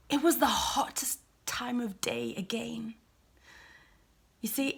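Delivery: ordinary talk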